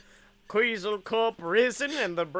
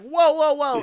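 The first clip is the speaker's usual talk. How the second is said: much higher, louder startled cry; startled whoa; 9 semitones higher, 9 dB louder than usual. Pretend. Guest slapped by Elsa, loud startled cry. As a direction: yelp it as if just slapped. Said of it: "And you're like".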